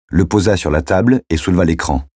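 usual speaking voice

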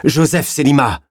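high, insistent call over sobbing. A narrator's reading. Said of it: "demanda-t-il plus fort, pour couvrir les sanglots".

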